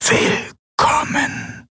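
slow, breathy ghostly whisper inside the mind. A narrator's reading. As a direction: breathe it out as a disembodied voice. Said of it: "eine Stimme in ihren Gedanken flüstern". (langsames, körperloses Flüstern)

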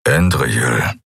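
a deep growling murmur; low grumble. A narrator's reading. Assassin grumbles her name, low growl. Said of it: "brummte er".

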